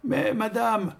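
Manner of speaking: reedy, high-pitched protest; shrill little old man. A narrator's reading. Old organist protesting to the baker, shrill and high. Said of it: "protesta l'organe aigu d'un petit vieillard".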